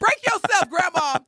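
high, hammed-up stick-up line; robber voice. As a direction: rob grandma like a street robber.

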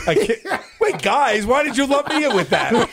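high, laughing objection; amused indignation; laughing protest. Real, not acted.

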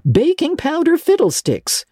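high, exasperated exclamation; scornful dismissal. A narrator's reading. Marilla dismisses Anne's baking powder excuse, scornful.

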